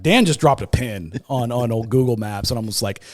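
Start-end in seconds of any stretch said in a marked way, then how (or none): none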